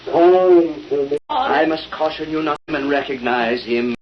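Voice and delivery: ordinary talk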